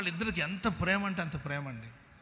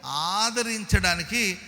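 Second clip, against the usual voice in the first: slow emphasis; 3 semitones higher, 6 dB louder, slower than usual. Acted. Repeats verse slowly.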